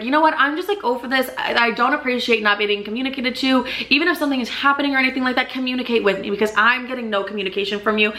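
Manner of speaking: normal talking voice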